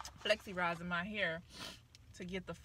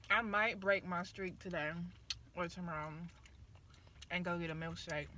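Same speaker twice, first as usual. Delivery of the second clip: chewing while talking; biting food mid-sentence; mouth full; 3 semitones lower, 4 dB quieter than usual. Real, not acted. Seen 0: Picks at nugget, then bites at lips while talking; chewing throughout.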